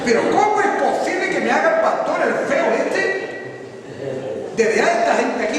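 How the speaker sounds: high, loud indignant parody; hammed-up offended churchgoer; mock indignation. Preacher imitating an offended member complaining about the pastor, mock indignant.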